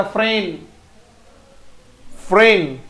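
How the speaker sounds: फ्रेंड as "fren", the "d" swallowed; short final D. Shown as a demonstration. Teacher shows pupils फ्रेंड, D cut short. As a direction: clip the ड off the end of फ्रेंड. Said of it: "डी को short बोला जाता".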